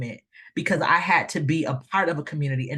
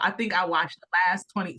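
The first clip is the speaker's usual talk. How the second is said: ordinary delivery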